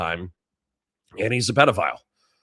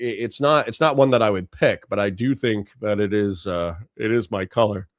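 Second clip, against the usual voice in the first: normal talking voice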